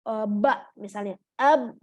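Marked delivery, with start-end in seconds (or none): 1.4-1.8 "ab" with the b held and stopped dead; stopped letter demo. Shown as a demonstration. Teacher shows the class how "ab" stops short. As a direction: say "ab" and brake hard on the b. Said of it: "Nah, kan ab dulu".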